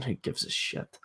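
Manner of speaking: whispered under the breath; a scornful whispered throwaway; dismissive whisper. Real, not acted. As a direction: toss it away in a hushed aside. Dismissive aside on results.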